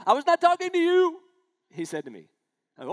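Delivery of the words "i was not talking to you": loud furious retort, pitched way up; angry shout. Retold or imitated. Irate shopper yelling at the pastor at full volume. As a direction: bark it back at full volume. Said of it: "He turned to me at full volume".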